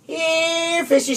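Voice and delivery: high sing-song call to a fish; coaxing high call. A narrator's reading. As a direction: call the fish sweetly from the bank. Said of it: "she called".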